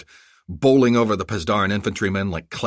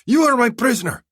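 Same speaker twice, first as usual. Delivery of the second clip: much higher, louder call; shouting; 7 dB louder than usual. Acted. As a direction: shout his name at the truck. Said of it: "he shouted".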